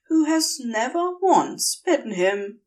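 plain reading voice

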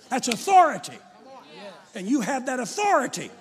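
loud, rising pulpit voice that drives every line home; fiery preacher's call to use authority; preaching at full force. Acted. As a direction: preach it hard to the congregation.

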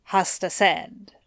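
usual speaking voice

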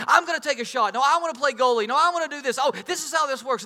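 put-on high, loud, eager kids chattering over each other; excited kids. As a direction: play the pumped-up kids talking at once. Excited kids all talking at once.

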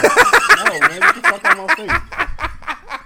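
high, loud, slow laughing protest; laughing rejection. Real, not acted. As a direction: push him away while cracking up.

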